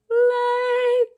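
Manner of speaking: high, loud, long-held "later"; singsong sign-off. Real, not acted. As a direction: stretch the goodbye out on one high ringing note.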